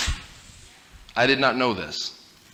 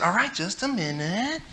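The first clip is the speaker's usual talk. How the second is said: put-on softer, slow, absent-minded kid reply; distracted kid; 7 semitones higher, 8 dB quieter than usual. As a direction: brush him off, still busy playing. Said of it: "I said".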